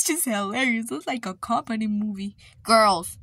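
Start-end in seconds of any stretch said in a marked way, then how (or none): 2.6-3.2 much louder stern call; scolding call. Real, not acted. Parent stopping the arguing sisters, loud stern call. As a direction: call them to order.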